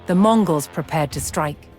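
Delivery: usual speaking voice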